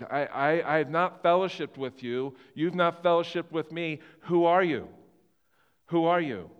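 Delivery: Jesus pushing them away, loud and pointed; voicing Jesus. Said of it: "This is, this is Jesus".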